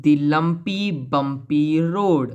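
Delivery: very slow, stretched-out words; deliberate word-by-word recitation; slow example reading. Shown as a demonstration. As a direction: draw out each word for the class. Reads out example of consonance.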